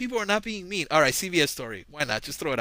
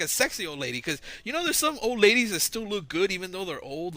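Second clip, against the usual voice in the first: usual speaking voice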